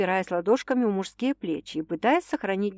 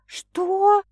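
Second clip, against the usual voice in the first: shocked what; 12 semitones higher, 5 dB louder than usual. A narrator's reading. Marriage announced.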